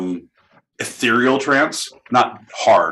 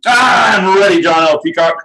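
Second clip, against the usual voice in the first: shouting; 9 semitones higher, 7 dB louder than usual. Acted. Yelled reply to host.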